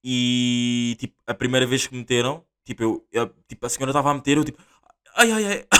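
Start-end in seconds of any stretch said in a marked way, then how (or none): 5.2-5.7 gemidos de dor. Retold or imitated. Pain while swab goes in.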